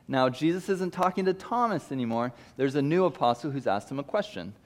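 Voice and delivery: ordinary delivery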